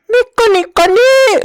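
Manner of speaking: very high, lilting mock call; mock prissy voice. Coworker calling the narrator's name, hammed-up prissy. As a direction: sing his name out daintily.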